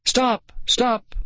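very high sharp cries; high-pitched desperate calls; narrated scream. A narrator's reading. Girl screams after the sled, screaming. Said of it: "Nicole screamed".

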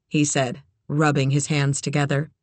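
ordinary delivery

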